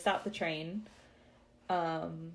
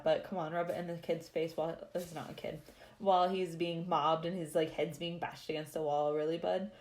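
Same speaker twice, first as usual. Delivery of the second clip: ordinary talk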